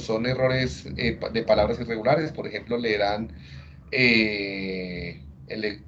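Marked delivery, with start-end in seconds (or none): none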